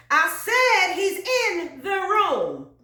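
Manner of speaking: high, loud, slow preaching cry; turning to preachment. Acted. Singer turns preacher before the congregation, full preachment. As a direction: preach it hard, stretching each word. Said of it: "then we gotta turn to preachment".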